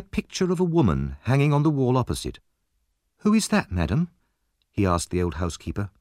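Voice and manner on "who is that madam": put-on curious boy. A narrator's reading. Boy asks about a picture.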